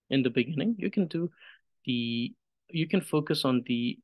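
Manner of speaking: ordinary talk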